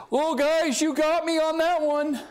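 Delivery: high, jokey admission of defeat; mock defeated Jesus. Preacher jokingly imagines Jesus conceding, high and mock-sheepish. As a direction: play it as a pretend caving in, pitched well up. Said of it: "No, that's not what he says here".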